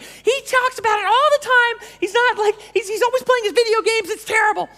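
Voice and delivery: high squeaky nagging wife impression; mock wife voice. Wife complaining about husband's video games, high mock whine. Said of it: "I hope that, that women's voice wasn't offensive".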